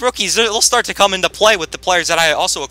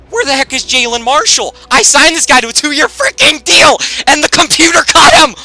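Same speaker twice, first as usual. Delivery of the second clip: high, loud indignant rant; shouting in disbelief at the computer; indignant outrage; 11 semitones higher, 8 dB louder than usual. Retold or imitated. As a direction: rage at the game. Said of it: "I'm like".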